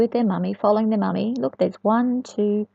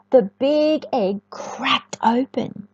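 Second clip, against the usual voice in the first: ordinary delivery, nothing marked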